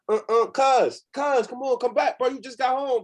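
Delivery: high, pleading cousin calling after him. Retold or imitated. Cousin begging him to come back.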